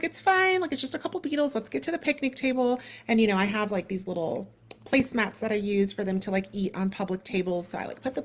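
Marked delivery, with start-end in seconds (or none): 0.0-2.8 put-on high, sing-song reassurance to the kids; higher soothing voice; mock soothing mom. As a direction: chirp it brightly to calm the children.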